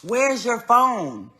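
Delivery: slow, loud, for lip-reading; slow mouthed question. Retold or imitated. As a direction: stretch each word so she can read your lips. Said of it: "I'm holding up my phone like this".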